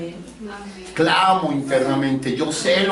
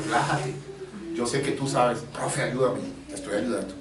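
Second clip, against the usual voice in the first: soft soothing; 5 semitones lower, 10 dB quieter than usual. Retold or imitated. Teacher soothes a tense student.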